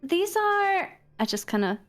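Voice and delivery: usual speaking voice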